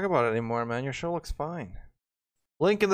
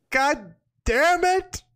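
mock rage yell; 11 semitones higher, 4 dB louder, much slower than usual. Mock rage quit in kitchen.

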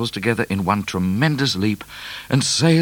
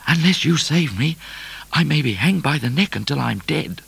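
anxious higher-pitched appeal; crying out; 8 semitones higher than usual. A narrator's reading. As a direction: beg your horse to save you. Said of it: "cried Midnight".

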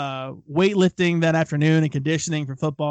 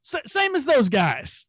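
higher, hesitant voice, badly faking being ill; unconvincing fake sick kid; 7 semitones higher, slower than usual. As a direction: stammer it out like a kid caught lying, slowed down. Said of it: "Did not sell it super well".

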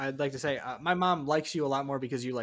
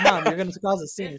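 louder pleading to mom; mock warning; 4 semitones higher, 6 dB louder than usual. Son warning his tipsy mom in a bar, louder. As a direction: hush mom with a raised voice. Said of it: "And I was like".

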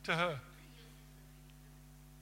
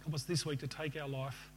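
closing prayer. Acted. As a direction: pray over the congregation unhurriedly.

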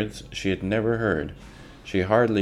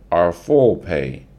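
slow, louder shout; 8 dB louder, slower than usual. Acted. Angry workers shout at Pa for their pay, slowly and loud. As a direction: demand it slowly from the mob.

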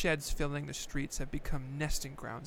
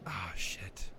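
much lower, unvoiced whisper; whispered oh shit; 9 semitones lower, 6 dB quieter than usual. Real, not acted. Caught out in the presidents game, dismayed whisper. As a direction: breathe it out in dismay. Realizing he'll lose the game.

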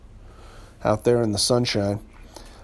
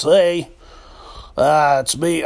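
DJ voice; 3 semitones higher, slower than usual. Shown as a demonstration. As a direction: play the smooth late-night DJ. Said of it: "slip back into D J voice".

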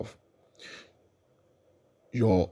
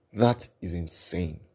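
usual speaking voice

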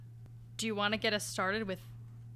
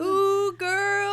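put-on sassy ooh girl; 9 semitones higher, 6 dB louder than usual.

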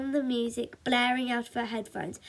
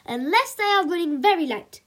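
high, loud concession; mock-pompous hero making an exception; superhero exclamation; 6 semitones higher, 6 dB louder than usual. A narrator's reading. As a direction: exclaim it grandly. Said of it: "exclaimed Super Mum".

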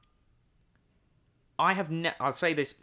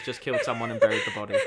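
laughing; 4 dB louder, faster than usual. Retold or imitated. Joking murder suspicion, laughing.

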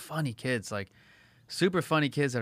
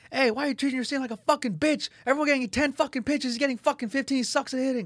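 high-pitched, mock indignant kid yelling across the field; retold kid's yelling; 14 semitones higher than usual. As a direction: imitate an outraged kid complaining, pitched way up. Kid yelling complaint from outfield.

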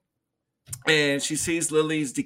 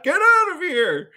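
very high, mock-frantic holler; hammed-up shouted order; retold yell; 12 semitones higher, 4 dB louder than usual. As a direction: imitate a man yelling someone away. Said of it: "he yells Marcy again".

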